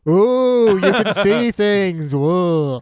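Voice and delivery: sarcastic ooh, drawn out; mock awed villain. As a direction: tease the boy who claims he sees things.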